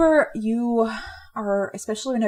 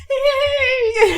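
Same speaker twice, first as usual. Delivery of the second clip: laughing; 5 dB louder than usual. Real, not acted. Laughing at own Grinch impression.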